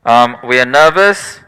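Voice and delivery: hammed-up panicking body voice; the body's voice. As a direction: play your body raising the alarm. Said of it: "It starts to go".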